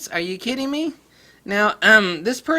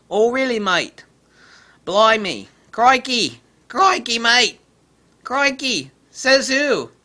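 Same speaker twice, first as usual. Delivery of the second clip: mocking Australian accent, a bit louder; 3 semitones higher, 4 dB louder, slower than usual. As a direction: take the mickey in a hammed-up Australian accent. Mocking the reviewer, Aussie slang.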